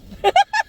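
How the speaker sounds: loud high burst of laughter; shrieking laugh. Real, not acted.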